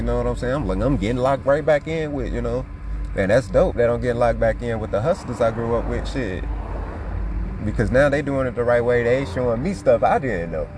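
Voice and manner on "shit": ordinary delivery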